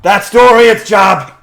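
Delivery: loud, high, drawn-out call; teasing slow holler. Acted. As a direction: shout it in through the open door. Varric calls in through opened door.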